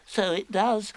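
high little animal voice; small squeaky echo of a friend; Piglet. Acted. Piglet agreeing with Pooh, small high voice.

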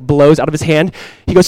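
normal talking voice

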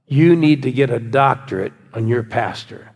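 ordinary talk

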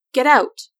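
a sharp shouted command; ordering shout. A narrator's reading. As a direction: order her out of the house. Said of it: "she ordered".

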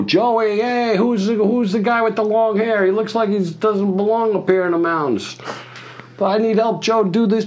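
very high put-on voice calling out; squeaky mock boss yelling for his helper; imitating Michael. Michael calling Joey over about the long-haired guy, high mock voice.

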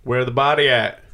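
gruff cop asking where the body is; a hint of a tough cop; cop impression. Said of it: "I was doing a cop's impression".

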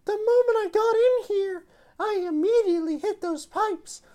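put-on high, confident young woman; Jolene voice. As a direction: explain the plan with smug confidence. S0 voices Jolene explaining plan.

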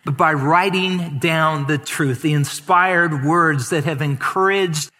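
praying for the congregation, earnest thanksgiving; closing prayer. Acted. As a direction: pray aloud with grateful fervor. Closing prayer over music.

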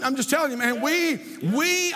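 ordinary talk

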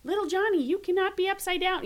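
high-pitched fussing parent voice; mock a hovering parent correcting a child; helicopter parent. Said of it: "like, that helicopter parent".

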